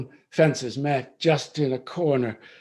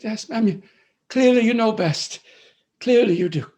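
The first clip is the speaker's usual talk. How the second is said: sobbing; 6 semitones higher, 5 dB louder than usual. Acted. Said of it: "Sobbing, weeping".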